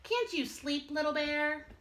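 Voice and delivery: ordinary delivery, nothing marked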